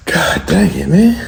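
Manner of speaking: breathless and winded; gasping after exertion; out of breath. Real, not acted. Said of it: "Why am I so out of breath right now?".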